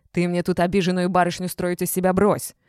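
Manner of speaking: loud angry outburst; explosive anger. A narrator's reading. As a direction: blow up at her. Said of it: "взорвался Иван Аркадьевич".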